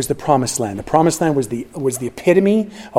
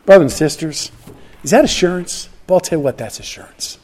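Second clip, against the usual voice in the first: ordinary talk, nothing marked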